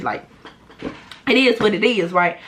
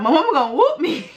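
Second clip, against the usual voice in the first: imitating crying; 7 semitones higher than usual. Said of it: "I just fucking broke down crying".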